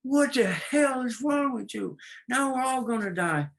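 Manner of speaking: a frog stung mid-lake, aghast; outraged frog. Acted. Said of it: "The frog look at the scorpion and says".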